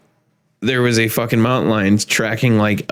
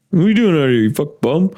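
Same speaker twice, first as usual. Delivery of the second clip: lightly voiced brother ribbing him, higher; mock teasing; 4 semitones higher, faster than usual. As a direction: tease like a big brother, pitched up. Brother's taunt repeated.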